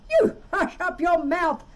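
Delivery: high, loud howl of rage. A narrator's reading. The mighty king howling at Mac below, loud. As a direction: howl him down. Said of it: "howled the mighty King Yertle".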